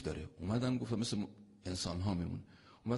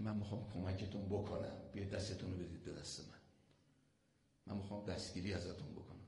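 much softer, fast tender pleading; tender coaxing; 2 semitones lower, 13 dB quieter, faster than usual. Retold or imitated. Imam Hussein tenderly coaxing his listeners, soft plea. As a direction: soothe and coax them gently. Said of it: "این داره ناز میکشه".

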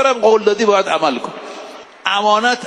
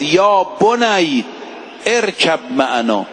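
slow recitation; slower than usual. Shown as a demonstration. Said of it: "این آیه رو حفظ کنید".

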